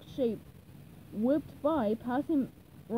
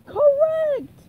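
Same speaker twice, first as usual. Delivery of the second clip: very high, loud announcement; loud declaration; 12 dB louder than usual. Acted. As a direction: boom out the verdict, high. Patrick approves the password.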